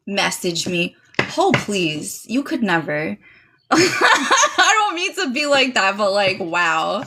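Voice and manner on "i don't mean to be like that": slow, laughing apology. Real, not acted. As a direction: laugh through the words.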